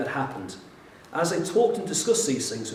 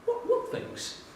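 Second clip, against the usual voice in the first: playing dumb, airy and much higher; Jesus innocently asking the disciples, much higher; innocent question; 5 dB quieter, slower than usual. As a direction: feign innocence, light and breathy. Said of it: "rather innocently goes".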